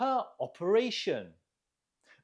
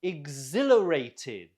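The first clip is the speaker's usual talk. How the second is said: Exhilarated with ZIL stretched and louder; stress on ZIL; 2 semitones lower, 6 dB louder than usual. Shown as a demonstration. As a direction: punch the second syllable of Exhilarated. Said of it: "Note the stress on the zil".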